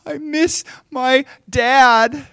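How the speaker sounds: put-on high, slow, loud little-boy wail; imitated crying child. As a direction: wail like a tearful boy missing his father. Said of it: "he just starts crying".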